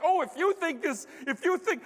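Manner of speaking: high-pitched mock outrage; indignant bluster. Passenger at the gate rejecting airline apology, indignant bluster. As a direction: start a huffy tirade, voice way up.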